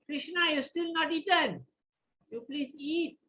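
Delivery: put-on high-pitched, earnest child's plea; pleading child. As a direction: beg like a little boy puzzled at an untouched meal. Child pleads with Krishna to eat.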